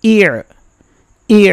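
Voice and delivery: ই pulled from deep in the throat; deep throat ain. Shown as a demonstration. Teacher demonstrating the throat letter to viewers, ই from deep in the throat. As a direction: make ই from the throat, slowly. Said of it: "আইন গলার মধ্যে".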